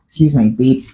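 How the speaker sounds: ordinary talk, nothing marked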